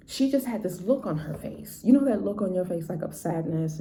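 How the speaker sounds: ordinary talk, nothing marked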